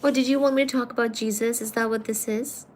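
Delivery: normal talking voice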